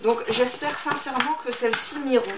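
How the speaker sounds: normal talking voice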